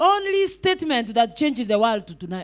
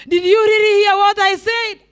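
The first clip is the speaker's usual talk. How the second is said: high, raised preacher's challenge; loud challenge; 6 semitones higher, 6 dB louder than usual. Real, not acted. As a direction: throw the question out loudly at the congregation. Challenging listeners after laughing, voice raised.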